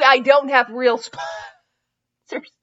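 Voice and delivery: usual speaking voice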